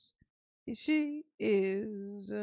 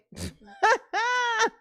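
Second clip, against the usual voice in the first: loud, very high cackle; bursting out laughing; 12 semitones higher, 6 dB louder than usual. Real, not acted. Cracking up over the babies and tomatoes, bursting out laughing. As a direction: break into a real high shriek of laughter.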